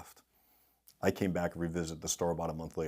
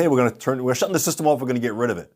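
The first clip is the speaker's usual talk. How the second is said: loud, raised voice, teasing the guys; mock teasing announcement; 4 semitones higher, 9 dB louder, faster than usual. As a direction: call it out like a prank announcement. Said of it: "And I tell 'em".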